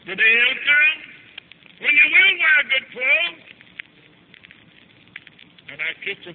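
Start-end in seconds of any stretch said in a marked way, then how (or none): 0.0-3.4 loud, high, stretched-out prophecy; rising promise. Retold or imitated. Mother promises her poor child better days, rising promise. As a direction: swell from comfort into a ringing promise.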